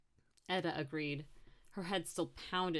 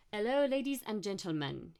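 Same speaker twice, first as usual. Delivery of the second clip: higher-pitched French tour guide greeting; showy French-accented announcement; thick French accent; 5 semitones higher, 4 dB louder than usual. Acted. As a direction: welcome them in a heavy French accent. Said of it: "he said in a thick French accent".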